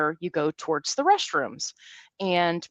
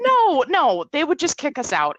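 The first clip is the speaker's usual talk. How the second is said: higher, louder no, no; reassuring protest; 7 semitones higher, 4 dB louder than usual. Retold or imitated. Reassuring worried dad they'd only be kicked out, loud. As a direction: brush off the worry emphatically. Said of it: "I'm like".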